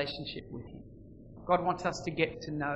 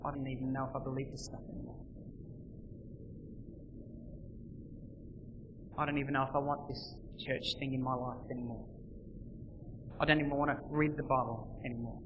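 weary defeated sigh; 4 semitones lower, 9 dB quieter than usual. Acted. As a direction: lower and much softer, giving up. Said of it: "throw your hands in the air and go".

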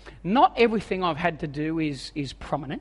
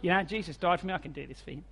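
softer, lower, breezy brush-off; faked casual calm; 4 semitones lower, 6 dB quieter, faster than usual. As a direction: pretend it's no big deal, quietly.

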